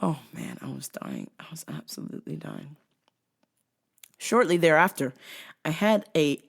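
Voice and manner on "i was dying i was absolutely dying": hushed whisper; breathless whisper. Real, not acted. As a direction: whisper it like a secret. Whispered laughing aside.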